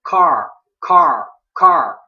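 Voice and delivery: car with a loud American R; loud R demo. Shown as a demonstration. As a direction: belt out car, curling the R. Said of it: "có âm R".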